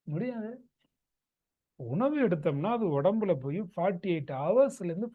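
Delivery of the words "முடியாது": soft, slightly raised-pitch no; softened, matter-of-fact refusal; unaggressive refusal. Acted. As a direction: say no gently, without any aggression. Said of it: "என்ன ஆக்ரோஷமா சொல்றேன்?".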